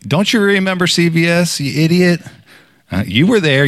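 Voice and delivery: high-pitched taunting comeback; mock-indignant rebuke; defiant retort. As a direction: throw it back at the accuser. Talking back to the accusing enemy.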